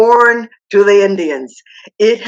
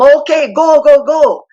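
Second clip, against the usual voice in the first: higher, louder shooing; 5 semitones higher, 4 dB louder than usual. Retold or imitated. Papa sending her husband away again, exasperated. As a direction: wave him off again.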